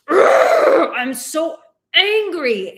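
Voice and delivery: loud frustrated ugh, slowed angry words; mock angry groan. Shown as a demonstration. Therapist demonstrating venting anger with a loud groan. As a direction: stomp and vent it out loud. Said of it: "stomping and just going".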